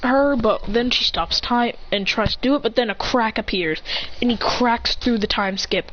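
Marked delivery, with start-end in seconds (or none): none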